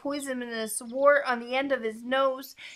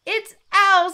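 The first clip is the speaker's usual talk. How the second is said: high, loud announcement; excited mouse; 11 semitones higher, 10 dB louder than usual. A narrator's reading. Mouse spots owl ahead, high and excited. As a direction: pipe up loudly as the mouse.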